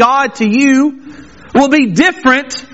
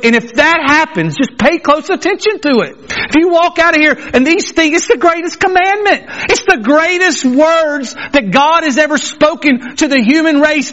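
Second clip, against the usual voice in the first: usual speaking voice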